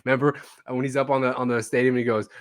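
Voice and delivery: normal talking voice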